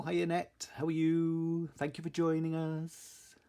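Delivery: normal talking voice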